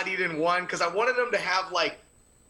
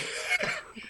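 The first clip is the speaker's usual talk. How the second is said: burst of laughter; 6 dB quieter than usual. Real, not acted. Laughing at the question.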